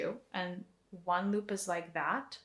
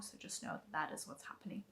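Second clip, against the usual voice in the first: soft unvoiced whisper; hushed reassuring aside; 2 semitones lower, 12 dB quieter than usual. Real, not acted. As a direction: whisper it gently to the listener. Whispered reassurance in meditation lesson.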